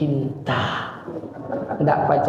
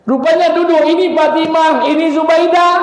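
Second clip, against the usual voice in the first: high, loud announcing narration; dramatic reveal; 14 semitones higher, 7 dB louder than usual. A narrator's reading. Preacher revealing Fatimah and Zubaidah, loud and high. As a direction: announce who is sitting there like a big reveal.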